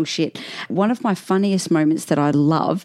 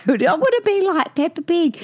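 imitating a child; 10 semitones higher than usual. Child quoted wanting to copy Peppa.

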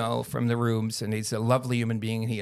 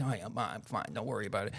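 deflecting; 3 semitones lower, 9 dB quieter, faster than usual. Retold or imitated. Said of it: "minimalize, deflect".

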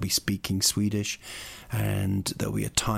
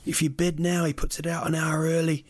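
hyping seller; 6 semitones higher than usual. Retold or imitated.

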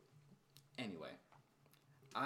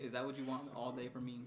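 ordinary talk, nothing marked

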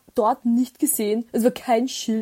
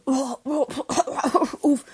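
mock panting; 4 semitones higher, slower than usual. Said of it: "komplett außer Atem".

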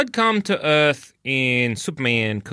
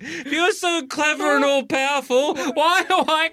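high, whining mock Lex; whiny high villain; 12 semitones higher than usual. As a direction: whine like a petulant villain. Imitating Lex, high pitched.

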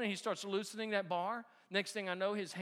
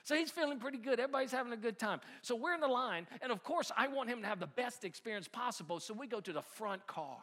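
ordinary delivery

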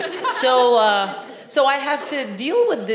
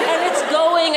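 ordinary delivery

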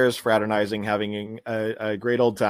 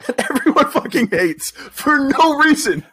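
mock outraged squeal; exaggerated high repeat; 13 semitones higher, 5 dB louder than usual. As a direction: pitch it way up, mocking.

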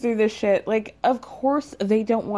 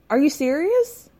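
incredulous; 6 semitones higher than usual. Real, not acted. Exasperated rhetorical question.

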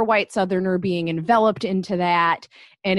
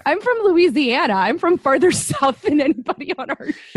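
raised, louder indignant protest with a laugh; indignant mock retort; 8 semitones higher, 7 dB louder than usual. Co-host answering the fake-Southerner accusers, indignant and laughing. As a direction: protest it, half laughing, pitch up high.

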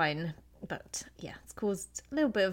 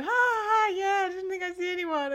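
much higher, forced laugh and excuse; awkward laugh; 11 semitones higher than usual. Retold or imitated. Retelling her own awkward reply to friends, much higher. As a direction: laugh it off in embarrassment. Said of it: "I had to sort of, like, go".

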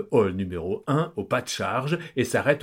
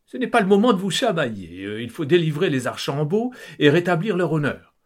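higher, louder, fast scolding; scolding wife; 6 semitones higher, 4 dB louder, faster than usual. Acted. Wife scolding quarrelling husband and daughter, fast higher voice. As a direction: hurry them, cut the bickering. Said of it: "les interrompt Kitry".

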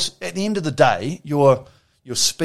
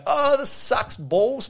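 mock whine; 10 semitones higher, much slower than usual. Rowers moaning in bad weather.

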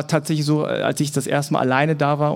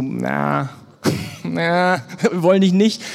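mocking rejection; 2 semitones higher, slower than usual. Germans rejecting the Korean-German kid, teasing and dismissive. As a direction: mimic a smug playground refusal. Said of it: "sagen die Deutschen so".

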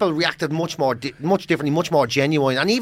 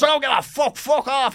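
high, loud mock yell; mock shout; 11 semitones higher, 3 dB louder than usual. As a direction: bark it like an angry old man. Old man shouting at crew.

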